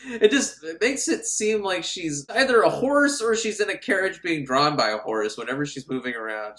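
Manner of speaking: normal talking voice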